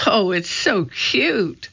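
high, slow cooing; drawn-out, delighted gush. A narrator's reading. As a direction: coo over an adorable baby animal. Annie gushing over baby animal.

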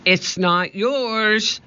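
high, slow defiant call; shouting. A narrator's reading. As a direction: call out the protest high and drawn out. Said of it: "shouted Annie".

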